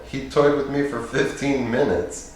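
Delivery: ordinary delivery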